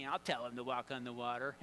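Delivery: low, scheming mock-ghost voice; playfully sinister low aside; evil ghost. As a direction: plot it like a cartoon villain. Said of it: "it was an evil ghost".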